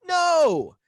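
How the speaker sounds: high, loud, indignant yell; re-enacted outraged refusal; acted angry refusal. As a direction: shout back at your sister. Retold quarrel with sister.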